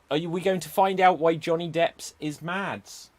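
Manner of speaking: normal talking voice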